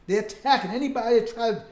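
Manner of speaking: rising, thundering pulpit delivery; full-force preaching. Acted. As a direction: preach it hard to the congregation.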